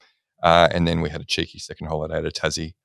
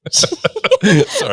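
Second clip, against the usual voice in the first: breaking into laughter; laughing; 6 dB louder than usual. Real, not acted. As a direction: laugh through the apology. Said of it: "I can't say that with a straight face".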